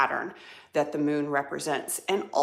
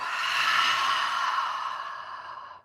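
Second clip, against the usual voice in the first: long, airy sigh out; audible sigh, slowly released; long exhaling sigh. Shown as a demonstration. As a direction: let a big breath sigh all the way out. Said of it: "Sigh it away".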